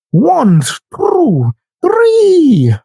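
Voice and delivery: lightly imitated counting vampire, last number jumping high. Mocking her as a counting vampire, lightly hammed-up count voice. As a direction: count out like a mock vampire, one number at a time. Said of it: "The count never gets the count wrong".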